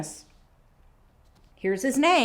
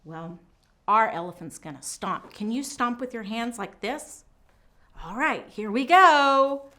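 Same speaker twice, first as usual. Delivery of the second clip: ordinary talk, nothing marked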